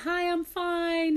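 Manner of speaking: high, slow chirpy "hi, I'm fine"; mock-bright reply before being asked; premature cheery reply. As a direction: answer too eagerly. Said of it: "you're like".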